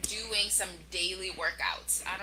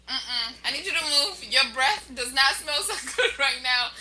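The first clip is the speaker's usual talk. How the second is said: laughing complaint; 5 semitones higher, 9 dB louder than usual. Retold or imitated. Pushing husband away, laughing.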